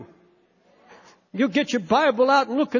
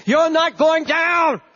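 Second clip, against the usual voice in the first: slow, higher and loud, every word driven home; defiant shout; 6 semitones higher, 4 dB louder, slower than usual. Real, not acted. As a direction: cry it out over the hurting listener. Preacher shouting encouragement.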